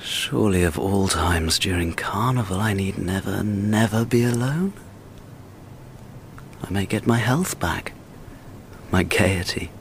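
low, soft, hopeless inner voice; low brooding. Acted. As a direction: brood to yourself in despair. Lonely man broods quietly.

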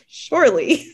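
"surely" stretched out through a laugh; laughing disbelief. Retold or imitated. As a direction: laugh into the word, half crying. Hoping mom would approve, laughing.

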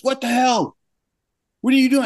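high, strained scolding; retold boss's outrage. Boss coming down on animator tipping desks, appalled.

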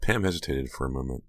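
usual speaking voice

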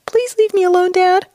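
high put-on voice for a pet; pet's imagined voice. Owner speaks for pet pleading with Dad, high put-on voice. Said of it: "She's like".

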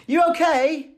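high Manchester English "You okay?"; English accent. Retold or imitated. Imitating the illustrator's Manchester greeting, English accent. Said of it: "You love the accent".

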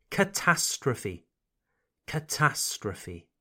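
Catastrophe said kuh-TAS-truh-fee, final E sounded; English catastrophe. Shown as a demonstration. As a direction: model Catastrophe, stress on TAS. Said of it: "we pronounce the final E sound".

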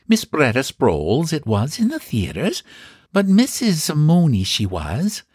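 high, prim landlady voice; a proud, chatty housekeeper; old landlady voice. Acted. Housekeeper answers.

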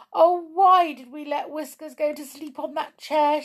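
high, loud, acted wail; weeping lament. Molly regretting letting Whiskers sleep there, weeping. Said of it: "she we-, wept".

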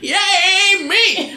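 high squealing mock cheer. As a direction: shriek it as an old lady. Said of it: "and I'm gonna be".

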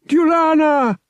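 loud, very high cry of grief; anguished yell. Acted. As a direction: cry out as she falls. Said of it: "Han yelled in anguish".

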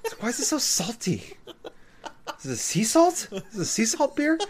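high, laughing mock complaint; mock disgust. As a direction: whine about the salty beer. Joining the mock-taster bit.

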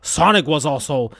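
usual speaking voice

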